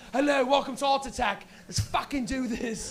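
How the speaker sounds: put-on British TV host; British accent. British guy opening the art show, mock British accent. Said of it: "zoom in on the British guy".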